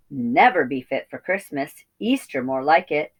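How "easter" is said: ordinary delivery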